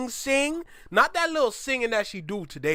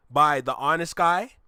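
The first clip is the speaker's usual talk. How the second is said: normal talking voice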